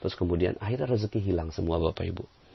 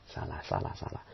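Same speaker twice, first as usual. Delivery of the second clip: "salah" repeated, each softer until almost gone; wife quietly admitting she was wrong; fading self-reproach; 4 semitones lower, 12 dB quieter than usual. Retold or imitated. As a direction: let it die away as it dawns on you.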